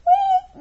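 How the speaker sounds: high loud whistled "wee"; whistle call. A narrator's reading. Ava calling the silent bird, high whistle. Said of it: "whistled Ava".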